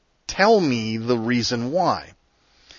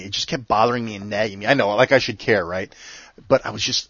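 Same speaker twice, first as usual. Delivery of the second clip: ordinary delivery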